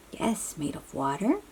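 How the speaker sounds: ordinary delivery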